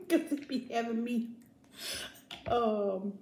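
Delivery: laughing while talking. Real, not acted. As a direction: giggle through the words. Laughing at own paranoia.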